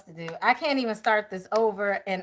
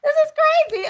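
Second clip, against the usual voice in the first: much higher and louder, half laughing; high, excited; 7 dB louder, much faster than usual. Real, not acted. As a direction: blurt it out, overwhelmed and giggly. Overcome by yawns and laughter.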